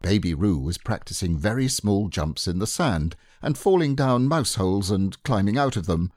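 usual speaking voice

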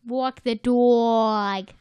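slow New York vowels on "dog"; drawn-out "Walk the dog" with a New York dog; New York accent. Retold or imitated. Friends trading New York words, drawling dog slowly. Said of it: "I say car".

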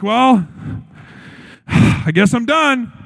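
mock defeated sigh, slowed down; resigned victim. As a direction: give up with a big sigh. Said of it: "Not crying out as a victim".

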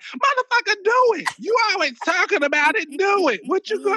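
high, loud taunting dare; high-pitched mock challenge to dad; mock defiant yell. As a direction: goad him to just do it. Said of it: "I used to be like".

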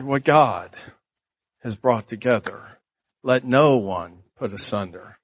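low, measured wedding pronouncement; wedding preacher. Retold or imitated. Preacher pronouncing the couple married, low and solemn. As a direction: lightly imitate a solemn officiant, pausing between phrases. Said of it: "And the preacher said".

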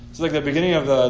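ordinary delivery

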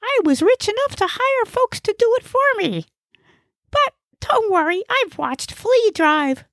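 high-pitched, cocksure character voice; very high comic know-it-all; know-it-all Gnesi. Acted. Gnesi dismisses Ig's worry about his driving, high cocksure voice.

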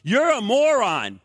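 slow, loud mock sneer; mock sneering insult; retold insult. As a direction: drag it out like a jeering stranger. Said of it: "he goes".

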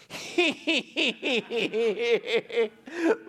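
a sly kid's giggle, imitated; imitated mischievous cackle. Son locking family out, imitated mischievous cackle. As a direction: cackle like a little troublemaker. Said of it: "like that".

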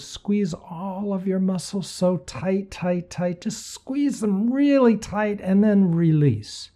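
strained squeeze. Acted. Coaching muscle squeeze.

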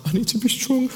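high, squeezed voice through tears; a hammed-up tearful squeak; mock brave sob. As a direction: pretend to hold it together while crying. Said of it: "I'm like".

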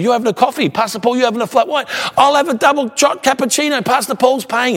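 high, boisterous café ordering; eager impression, pitched right up; imitating loud talker. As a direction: mimic someone ordering for the whole room to hear. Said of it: "talk at one volume loud".

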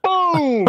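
high yelped boom for the electric jolt; shouted boom. Retold or imitated. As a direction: blurt the shock of the fence. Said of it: "And I go".

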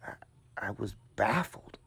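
breathless baffled whisper. A narrator's reading. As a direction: whisper it in disbelief. Stunned narrator whispers.

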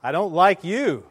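imitated echo reply. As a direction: answer back as the echo. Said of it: "the voice came back".

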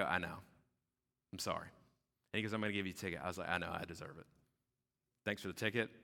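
low, subdued apology; contrite acceptance. Acted. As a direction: accept the blame quietly and meekly. Acted humble reply to ticketing cop.

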